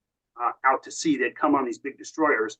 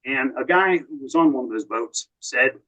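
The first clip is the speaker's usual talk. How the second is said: ordinary talk, nothing marked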